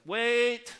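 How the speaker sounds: loud, high-pitched shout to stop someone; imitated neighbor calling someone back. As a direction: act out a sudden "hold on" yell. Acts neighbor calling him back.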